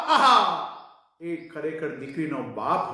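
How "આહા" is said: high, loud "aha"; amazed exclamation. Acted. Preacher marvelling at the twist, high and loud.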